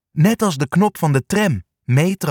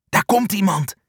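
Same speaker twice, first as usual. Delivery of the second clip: high, tense little alert; lightly acted hiss; hissed warning; 7 semitones higher than usual. A narrator's reading. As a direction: warn the others that someone's coming. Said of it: "sist Sja".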